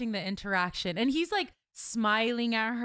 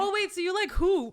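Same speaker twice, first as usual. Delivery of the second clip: much higher, teasing sudden realisation; mock realisation; 10 semitones higher than usual. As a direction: pretend the penny just dropped. Mock realisation he plays basketball.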